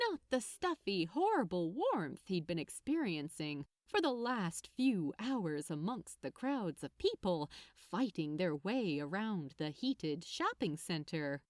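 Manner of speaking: ordinary delivery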